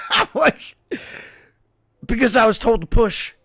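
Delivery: high, loud, cracking up mid-story; laughing. Real, not acted. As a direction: laugh while protesting your innocence. Cracks up retelling his answer.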